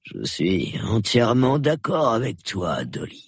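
slow sleepy drawl, fading away; drowsy agreement. Acted. Sleepy colonel humours his wife, drowsy and fading. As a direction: agree slowly while sinking back into sleep.

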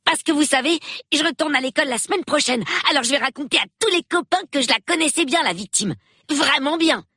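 put-on a nine-year-old thrilled to meet the police; excited little boy. A narrator's reading. Peter boasts to the police. (petit garçon tout excité, voix plus aiguë)

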